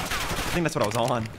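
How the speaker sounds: ordinary talk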